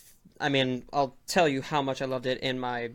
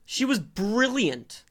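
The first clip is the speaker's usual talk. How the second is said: emphatic protest; 9 semitones higher, 3 dB louder, much slower than usual. Real, not acted. Defending actress in heated argument.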